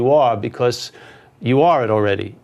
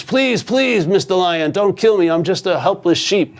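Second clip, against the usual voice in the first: high, begging little voice; pleading young lion; 5 semitones higher than usual. Acted. Young lion pleading with the old lion, high and begging. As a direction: beg for your life in a small high voice. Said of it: "the young lion rolls over on its back and says".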